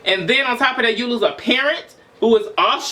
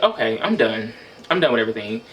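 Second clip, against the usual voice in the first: hopeless resignation; 10 semitones lower, faster than usual. Retold or imitated. To himself, at his lowest, hopeless and low. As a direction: say it like you've given up. Retelling giving up, voice drops.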